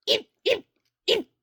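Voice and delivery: very high squeaky eep yips; squeaky little dog yips. Retold or imitated. Husband mimics wife as a tiny yipping dog. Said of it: "She goes".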